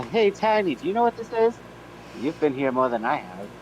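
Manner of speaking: ordinary talk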